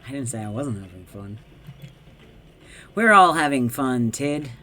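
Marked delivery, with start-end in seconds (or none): 2.9-4.5 loud, pushy insistence; loud mock-cheerful insistence; forced cheer. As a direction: shout the fun at them. Insisting everyone has fun.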